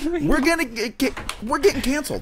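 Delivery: high-pitched worried protest; mock panic. Real, not acted. Co-host fears being canceled, high mock panic. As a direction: protest it high, in comic alarm. Protests the edgy improv bit.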